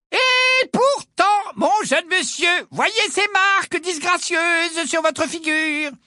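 very high, drawn-out quack's voice; portrait of a healer, insistent. Acted. Portrait healer points out marks on Ron's face, high and drawn out. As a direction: insist slowly and theatrically on the diagnosis.

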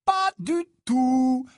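high, loud, drawn-out refusal; resolute. A narrator's reading. Harry refuses his friends' objection, resolute. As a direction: stretch it out with cheerful firmness. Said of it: "répliqua Harry d'un ton résolu".

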